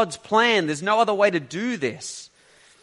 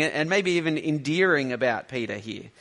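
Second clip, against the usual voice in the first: normal talking voice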